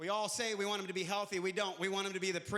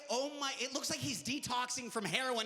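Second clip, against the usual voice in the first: ordinary talk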